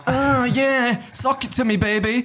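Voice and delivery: high, hammed-up moaning lover; high mock bedroom moan; mock sex moan. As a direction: camp it up as a moaning woman. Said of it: "That doesn't sound like".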